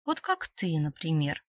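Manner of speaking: soft, teasing purr; hushed, needling insinuation; provocative purr. A narrator's reading. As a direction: purr it as a quiet dig. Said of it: "провокационно проурчав".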